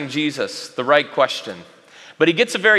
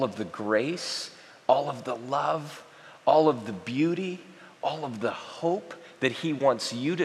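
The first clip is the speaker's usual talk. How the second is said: softly pleading preacher; tender sermon appeal; 3 semitones lower, 6 dB quieter than usual. Acted. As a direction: draw them in with quiet love.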